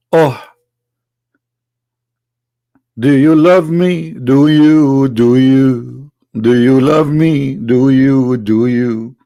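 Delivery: lightly hammed-up lovesick pleading, slow; mock seductive plea.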